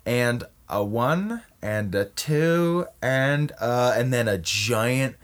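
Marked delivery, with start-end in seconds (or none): 0.1-3.9 drawn-out mock countdown; slow count-off. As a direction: stretch each number out with ceremony. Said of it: "And he's like".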